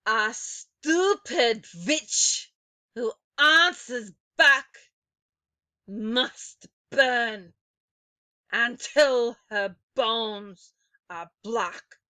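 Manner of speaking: slow, loud, menacing witch chant; bloodcurdling voice. A narrator's reading. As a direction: chill the room with each word. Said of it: "in a voice that made my blood run cold".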